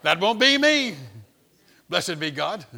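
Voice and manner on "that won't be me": loud, high insistence; not me, not me, shouted high; mock protest. Car passengers protesting to the driver, loud and high.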